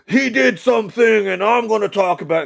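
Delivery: mocking a commentary channel announcing a video; hyped commentator. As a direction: sell it like a drama YouTuber. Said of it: "like, uh".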